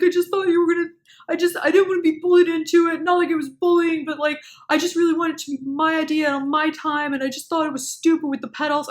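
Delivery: a hint of a whiny boyfriend; boyfriend's excuse. Boyfriend's excuse voiced by narrator.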